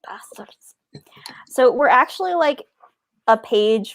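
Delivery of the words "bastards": breathy whispered echo; hushed mock curse. Real, not acted. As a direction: drop to a whisper.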